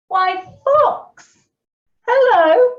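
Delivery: very high, loud greeting; lightly voiced mouse. A narrator's reading. Mouse greets Fox, loud high acted hello. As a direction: sing it out high as a little mouse.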